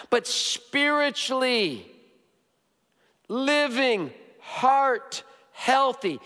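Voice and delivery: slow, high, drawn-out preaching; slow emphasis. Acted. Pastor slowing down to stress spiritual health. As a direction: stretch each word out to land it.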